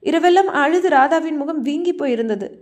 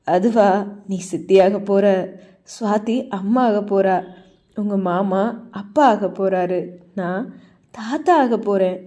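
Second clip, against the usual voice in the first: put-on lower, mildly pleased old man; proud father's news; 4 semitones lower than usual. A narrator's reading. Father telling Radha about the coming baby, lightly voiced.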